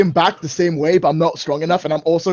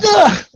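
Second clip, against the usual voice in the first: higher-pitched punching grunt; effort grunt; 8 semitones higher than usual. Acted. Old father punches his son in the face, effort grunt. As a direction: grunt as if throwing a feeble punch. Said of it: "it's not gonna be very hard".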